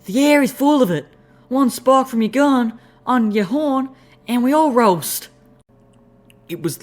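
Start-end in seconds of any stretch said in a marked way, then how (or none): 0.1-5.3 like a tiny woodland critter warning; squeaky critter voice. Acted. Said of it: "high-pitched, squeaky voice that came out".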